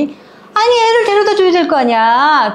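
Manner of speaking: high-pitched grandmotherly exasperation; impatient scolding. Acted. Grandmother tells the woman to return the baby, exasperated.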